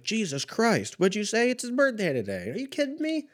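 very high-pitched, squealed disbelief; hammed-up high indignant retort; mock incredulity. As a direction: act out exaggerated outrage in a squeaky voice. Re-enacting mock outrage at family.

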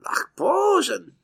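slow, high mock German words; lightly hammed German accent. Joking about Germans, slow mock German accent. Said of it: "that's what Germans say".

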